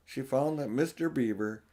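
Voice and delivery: ordinary delivery, nothing marked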